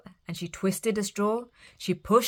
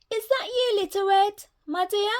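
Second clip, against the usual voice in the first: put-on much higher old granny voice; high granny voice asking who's there; old Granny calling; 14 semitones higher, 4 dB louder than usual. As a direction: call through the door like a sweet old granny. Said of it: "called Granny".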